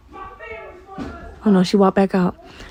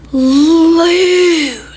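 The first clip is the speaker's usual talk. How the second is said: high, louder sing-song taunt of loser; mocking loser taunt; 7 semitones higher, 6 dB louder than usual. Little sister gloating at her sibling, high mocking taunt. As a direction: jeer it like a smug little sister.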